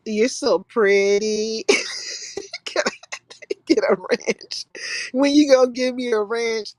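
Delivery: slow, hammed-up come-on; mock flirt. Teasing Big O for hitting on the ladies, slow mock flirt. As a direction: drag it out like a try-hard flirt. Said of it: "Big O come in like".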